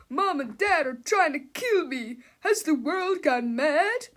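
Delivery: loud, high, slow panicked voice; frantic wail. A narrator's reading. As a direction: wail it like the world is ending. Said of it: "he cried".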